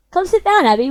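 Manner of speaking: high, bubbly and sweet; cheerful girl voice. Acted. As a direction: call her over happily. Sayori greets the player.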